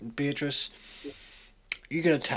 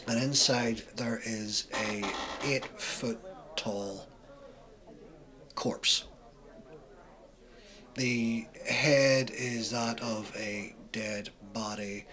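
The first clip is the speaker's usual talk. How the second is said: ordinary talk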